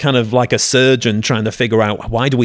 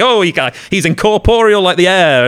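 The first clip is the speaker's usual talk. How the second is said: higher, lightly voiced guard realizing the ghost is air; lightly acted baffled guard; startled guard; 9 semitones higher, 4 dB louder than usual. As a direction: play the baffled guard finding nothing to hit. Acting out Hamlet ghost scene.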